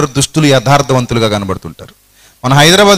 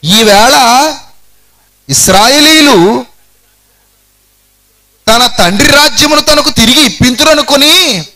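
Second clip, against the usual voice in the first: higher, slow conniving tale; scheming servant; 9 semitones higher, 4 dB louder than usual. Acted. Preacher voicing the scheming Ziba's lie, higher and sly. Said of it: "ఏమంటున్నాడు తెలుసా ఈ కుయుక్తిపరుడు".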